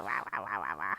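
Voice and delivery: angry ramble noise. Shown as a demonstration. Explaining a work joke, low muttered wah ramble. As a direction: growl an angry nonsense ramble. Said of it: "sort of like a angry ramble".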